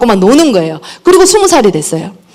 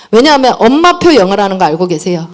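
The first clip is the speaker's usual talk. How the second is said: normal talking voice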